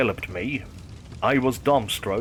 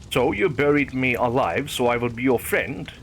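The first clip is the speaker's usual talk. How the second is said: ordinary delivery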